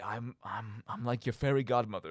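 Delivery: normal talking voice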